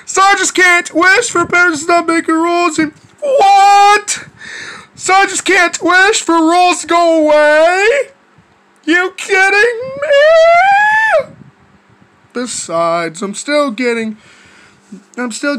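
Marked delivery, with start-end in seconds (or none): 0.1-11.3 outraged disbelief. Acted. Kid told the wishes are refused, incredulous outburst. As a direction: protest in disbelief, stretching the last question way up. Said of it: "And I'll be like".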